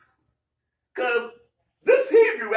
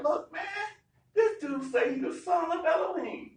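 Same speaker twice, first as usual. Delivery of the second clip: pleading accusers; 9 semitones higher, 3 dB quieter than usual. Retold or imitated.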